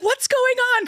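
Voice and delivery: put-on high child voice, lightly done; son's worried question. Son asking mom from the back seat, high and worried. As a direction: ask it like a worried kid. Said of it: "Jackson's like".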